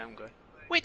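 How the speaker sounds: ordinary talk, nothing marked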